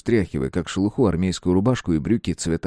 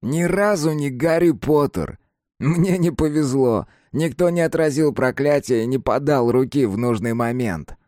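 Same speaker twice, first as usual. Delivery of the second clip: put-on teen boy voice; 8 semitones higher, 4 dB louder than usual. Kapustin's lines continue.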